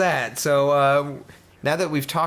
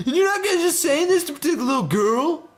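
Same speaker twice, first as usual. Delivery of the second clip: high woman voice; 14 semitones higher than usual. Acted.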